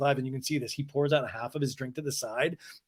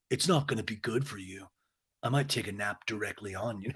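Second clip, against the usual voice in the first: Schwartz's soft threat; 5 semitones lower, 8 dB quieter than usual. Retold or imitated. Schwartz mildly threatens James.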